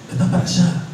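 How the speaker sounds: Argentine waiter. Retold or imitated. Restaurant waiter pointing a tourist away, offhand Argentine accent. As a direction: brush off the lost foreigner in Buenos Aires Spanish accent. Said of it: "And he just responds".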